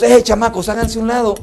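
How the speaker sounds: put-on loud, high shooing of the kids; disciples shooing. Disciples telling the children to move aside, loud. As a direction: shoo the kids away.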